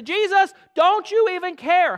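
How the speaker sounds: high, loud panicked plea; frightened disciples crying out to Jesus; desperate cry. Acted. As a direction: shout it over the storm in fear.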